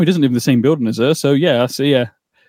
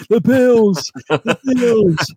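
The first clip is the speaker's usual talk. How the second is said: Hunchback of Notre Dame; 9 semitones higher, much slower than usual. Retold or imitated. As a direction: wail it like a hunchback. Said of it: "You sound like the Hunchback of Notre Dame".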